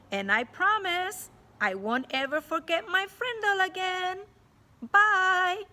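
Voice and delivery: high, loud cheeky farewell; cheeky boy. A narrator's reading. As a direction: savour the bold last line. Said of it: "I wanna read that last sentence again".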